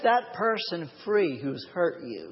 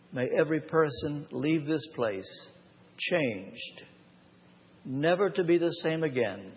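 prayer; 2 semitones lower than usual. Acted. Opening prayer before the sermon.

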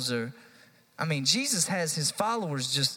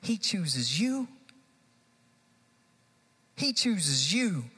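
slow, each word weighted; slow emphasis; 3 semitones higher, slower than usual. Real, not acted. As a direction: press it home slowly.

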